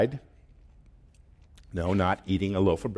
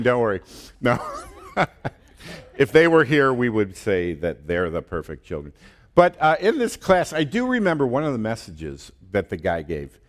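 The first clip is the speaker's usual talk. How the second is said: usual speaking voice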